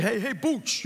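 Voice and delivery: put-on New York accent; playful Long Island impersonation. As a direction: greet a pal like a Long Islander. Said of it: "You know, talk like that".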